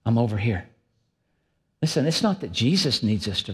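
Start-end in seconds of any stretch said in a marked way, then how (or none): 0.0-0.7 half-hearted call. Retold or imitated. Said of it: "And so we go".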